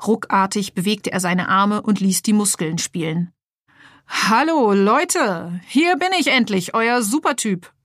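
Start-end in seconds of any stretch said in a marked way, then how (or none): 4.1-7.7 higher, boastful toy superhero voice; superhero announcing. Acted. Toy superhero proudly introduces himself to the lab, boastful high voice. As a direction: announce yourself like a puffed-up action figure. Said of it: "verkündete er".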